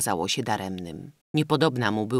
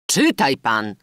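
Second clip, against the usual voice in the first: loud call at usual pitch; firm, raised-volume demand; 7 dB louder, slower than usual. A narrator's reading. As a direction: call out an order to the reader. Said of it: "zawołał prokurator".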